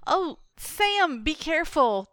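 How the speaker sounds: high-pitched cry of caution; acted concern for a stumbling friend; alarmed warning. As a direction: call out a worried warning, pitched well up. Player in character warns Sam.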